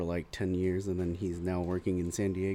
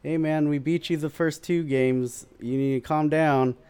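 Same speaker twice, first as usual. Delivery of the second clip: yelling banter; 6 semitones higher, 5 dB louder than usual. Retold or imitated. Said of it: "I yell over to him".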